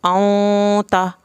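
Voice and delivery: औं ता drawn out slowly, nasal औं; slow ghunna reading. Shown as a demonstration. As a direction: stretch the nasal औं ता like a recitation drill. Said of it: "शुरू करते हैं". (औं ता धीरे, गुन्ना खींचकर)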